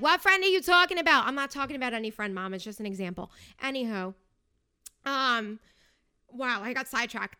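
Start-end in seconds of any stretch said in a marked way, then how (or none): 0.0-1.3 higher, louder mock mom voice; imitating mom. Imitating her mom texting her, higher and louder. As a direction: play the nosy mom demanding answers. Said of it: "be like".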